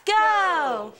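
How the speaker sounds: shouted cheer, leading the crowd; cheer call. Acted. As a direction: shout it to lead the room's cheer. Leading a call-and-response school cheer.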